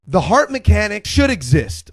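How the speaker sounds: normal talking voice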